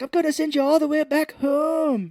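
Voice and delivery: loud, very high mock Irish threat; Irish accent. Retelling his threat to the mob, in a loud mock Irish accent. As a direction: belt it out in an exaggerated Irish accent. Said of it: "does an Irish accent to him".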